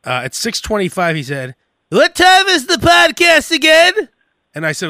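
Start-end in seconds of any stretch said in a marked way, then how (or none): 1.9-4.1 high, slow put-on voice of the texting friend; mock friend voice. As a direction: read his text in a high goofy voice. Retelling friend's texts in voice.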